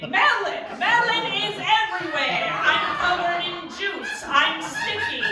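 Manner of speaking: high, loud wail of mock horror; horrified panic. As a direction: cry out each awful new discovery. Acts horrified discovery in bed.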